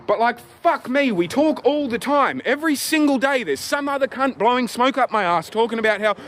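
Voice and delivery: worked-up rant. Real, not acted. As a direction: vent at full heat, voice climbing. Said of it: "Anyway, calming down".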